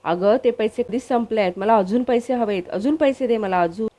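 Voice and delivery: ordinary delivery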